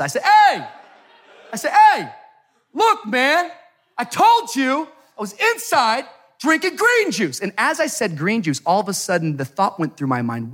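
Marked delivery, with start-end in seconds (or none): 0.2-2.1 high, loud hammed-up challenge; raised voice. Passenger standing up to screaming driver, acted raised voice. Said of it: "I raised my voice". 2.7-7.4 high, emphatic mock indignation; raised voice. Passenger telling off the Uber driver, acted raised voice. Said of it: "I raised my voice".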